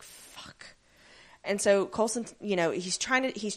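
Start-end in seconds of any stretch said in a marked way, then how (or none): 0.0-0.7 breathless whispered fuck; whispered curse. Retold or imitated. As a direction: whisper it in dread. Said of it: "And you're like".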